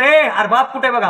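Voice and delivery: high, loud, stretched-out call. Retold or imitated. A guest calling out for the bride's father, high and loud. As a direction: shout to find someone in a crowd.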